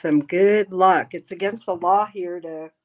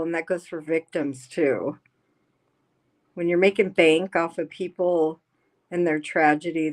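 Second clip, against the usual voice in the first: ordinary talk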